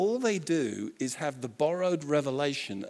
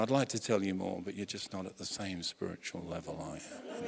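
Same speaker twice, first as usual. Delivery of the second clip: mock superior mystic; 7 semitones lower, 7 dB quieter than usual. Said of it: "You know".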